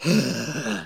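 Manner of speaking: deflating breath out; long outward sigh. A narrator's reading. The devil breathing out to shrink, a long sigh. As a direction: let all the air out. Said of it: "the devil breathed out".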